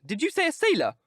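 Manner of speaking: high, loud panicked cry; alarmed outcry. Acted. Dorian presses the keeper about the sailor, high panicked cry. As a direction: shriek the question in dread. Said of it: "he cried out".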